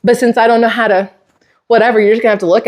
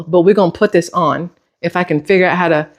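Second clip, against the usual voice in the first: ordinary delivery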